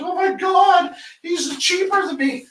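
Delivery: high, mocking whine of a rival; mock the rival's panic; whiny competitor. Mocking a rattled competitor, high whine. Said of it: "I have a competitor that's like".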